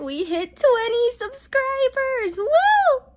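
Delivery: high, slow and loud cheering; excited cheer. Real, not acted. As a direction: celebrate the milestone at full volume. Exciting subscriber milestone announcement.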